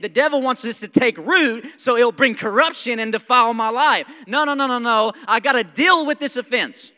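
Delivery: loud, high, driving sermon delivery; real pulpit crescendo; fired-up preaching. Real, not acted. Preaches at full force.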